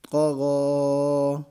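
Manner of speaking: قا غا with both vowels held one alif long, a heavy ق and غ; slow, heavy, drawn-out قا غا; stretched long vowels. Shown as a demonstration. Teacher stretches the vowels to show the class the length. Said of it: "ایک الف کی مقدار کھینچ کر پڑھیں گے".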